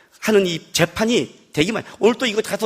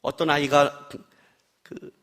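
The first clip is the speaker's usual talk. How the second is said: ordinary delivery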